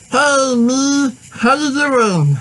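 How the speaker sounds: high alien voice, slow on the second half; Stitch voice. Retold or imitated. Walking up to the mascot, greeting him in a high Stitch voice. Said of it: "I did my Stitch voice".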